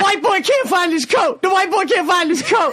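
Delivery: high-pitched mock heckler holler; imitated yell. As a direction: yell it like someone across a club. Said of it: "someone yells out".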